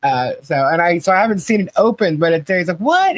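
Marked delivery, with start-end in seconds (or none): 2.8-3.2 much higher, disbelieving What; stunned What. Retold or imitated. Husband seeing the Pop Rocks box, much higher in shock. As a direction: squeak out his shock.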